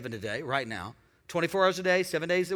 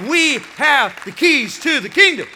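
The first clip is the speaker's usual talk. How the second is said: loud proclamation; 6 semitones higher, 11 dB louder than usual. Real, not acted. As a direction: shout it out, higher and louder. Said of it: "I wanna say it again".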